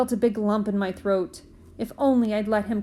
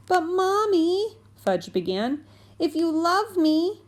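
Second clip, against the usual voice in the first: high, slow whiny plea; a little boy pleading with his mother; pleading child; 8 semitones higher, slower than usual. Acted. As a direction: beg Mommy like a wheedling little boy. Fudge pleading with Mom.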